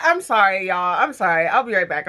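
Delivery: normal talking voice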